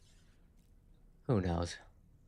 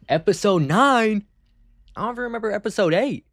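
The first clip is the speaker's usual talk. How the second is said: pitched way up, rushing the second line in disbelief; incredulous; 10 semitones higher, 5 dB louder than usual. Real, not acted. Streamer baffled by a viewer's episode question, incredulous high voice. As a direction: ask it like you can't believe the number.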